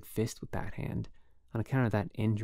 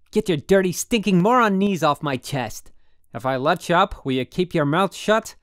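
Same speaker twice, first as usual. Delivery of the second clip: loud, much higher, furious shouting; furious yell; 7 semitones higher, 11 dB louder than usual. A narrator's reading. Holden pinned down yelling at his roommate, furious yell. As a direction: hurl every insult at him.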